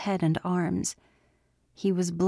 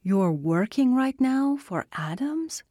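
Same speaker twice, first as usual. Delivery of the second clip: incredulous; 6 semitones higher than usual. Acted. Chris shocked, incredulous.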